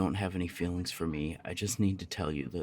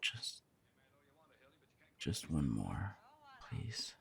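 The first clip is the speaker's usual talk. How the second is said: much softer, breathy begging between kisses; soft pleading; 4 semitones lower, 8 dB quieter, slower than usual. Acted. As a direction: ask for more in a hush.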